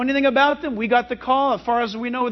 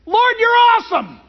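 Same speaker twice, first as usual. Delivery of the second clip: loud, high shout of praise; re-enacted joyful outburst; 10 semitones higher, 7 dB louder than usual. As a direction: holler it to the sky in awe. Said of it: "I just said out loud".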